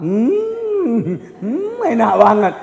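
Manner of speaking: mock mmm of pleasure; savoring. Child sneaking a taste of mom's cake, savoring. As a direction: hum like tasting something delicious. Kid tasting the forbidden cake.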